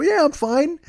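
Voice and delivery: high-pitched, unconvincing insistence; mock-defensive high squeal; agitated denial. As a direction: insist too hard, voice shooting up. Still worked up, claims he's fine.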